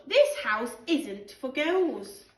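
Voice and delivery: slow stubborn pronouncement; bossy exclusion. Acted. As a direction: lay down the rule like a little tyrant. George excluding the girls.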